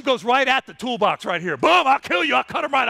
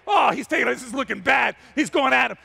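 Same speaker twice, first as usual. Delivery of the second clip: usual speaking voice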